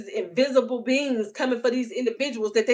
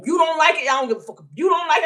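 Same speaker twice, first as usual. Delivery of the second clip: taunting shout; 7 semitones higher, 6 dB louder, faster than usual. Real, not acted. Repeated taunt at critics.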